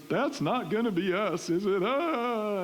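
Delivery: high-pitched imitated tearful wail; mock sobbing. Wife in the car fearing for their marriage, mock sobbing. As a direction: whine it out, breaking into a pretend cry. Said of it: "She's sobbing".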